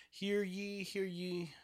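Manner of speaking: high, formal court-crier call; bailiff. Acted. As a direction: announce it like a bailiff opening court.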